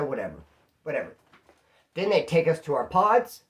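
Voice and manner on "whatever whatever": low, soft dismissive whatever. Retold or imitated. Giving up on the rude cellmate, dismissive and soft. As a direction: wave him off under the breath.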